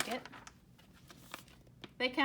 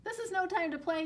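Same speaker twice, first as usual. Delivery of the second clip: mock yell; 7 semitones higher, 3 dB louder, faster than usual. A narrator's reading. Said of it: "yelled Duck".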